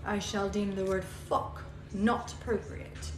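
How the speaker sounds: half-hearted posh proclamation; mocking whoever banned swearing, quiet pompous decree. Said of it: "the dude ... was like".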